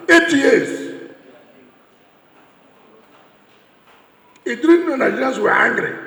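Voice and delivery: loud, ringing campaign-stage delivery; fiery rally oratory. Real, not acted. Speaker addressing a rally crowd about eight years, fiery loud oratory. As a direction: hammer each charge home to the crowd.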